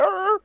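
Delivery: high startled creature hiss; owlbear hiss. Acted. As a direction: hiss like a scared cub. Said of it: "making a fucking hissing noise".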